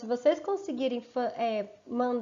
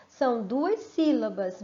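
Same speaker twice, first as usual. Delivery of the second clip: normal talking voice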